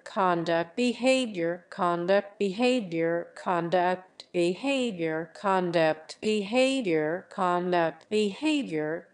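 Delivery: steady, even word-by-word repetition drill; vocabulary drill. Shown as a demonstration. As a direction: read each word out clearly like a listening drill. Repeats two vocabulary words in drill.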